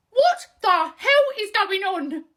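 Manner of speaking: loud, high, slow disbelief; shocked outburst. Acted. Discovering the body swap.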